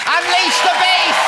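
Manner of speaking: announcer shout. Real, not acted. Host announcing the act.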